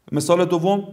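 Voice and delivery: ordinary talk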